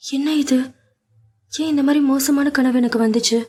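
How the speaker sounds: a hint of a frightened girl; anxious young woman. A narrator's reading. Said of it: "அவளது உள்ளம் தவித்துக் கொண்டிருந்தது".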